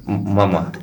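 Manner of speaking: giggly late night call. Acted. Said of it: "Que te pones muy meloso con tu churri".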